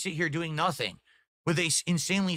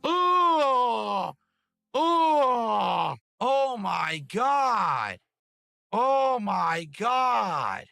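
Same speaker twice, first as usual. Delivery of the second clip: exasperated shout; 3 semitones higher, much slower than usual. Real, not acted. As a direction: wail in disbelief. Exasperated groan at game.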